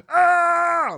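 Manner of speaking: sudden high shouted yell; [name] yell. Retold or imitated. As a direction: belt out a wild yell. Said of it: "he did his yell".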